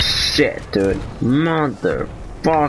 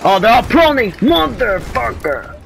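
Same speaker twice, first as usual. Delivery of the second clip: frustrated shout; 10 semitones higher than usual. Real, not acted. Enemies prone, frustrated.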